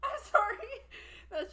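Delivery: laughing, high-pitched apology; giggling through the words. Real, not acted. Cracking up over the pet's name.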